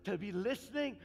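loud, high, urgent revival preaching; preaching at full force. Acted. Preacher warns husbands, wives and children, loud and urgent. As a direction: thunder a warning of the coming Lord. Preaching climax, warning congregation.

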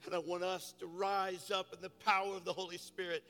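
loud, high fervent preaching; preaching at full force. Acted. Pastor rallying his church for the city, loud fervent preaching. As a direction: build the congregation up, shouting with fire.

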